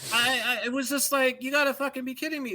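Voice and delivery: laughing. Real, not acted. Disbelief at the new voice.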